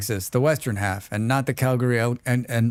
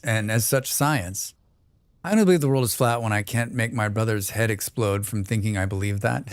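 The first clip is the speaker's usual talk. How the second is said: ordinary talk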